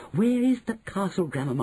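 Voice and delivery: put-on high, impatient boy's demand; can't wait another second; impatient cry. A narrator's reading. Boy pressing grandmother about the castle, impatient cry. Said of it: "I cried impatiently".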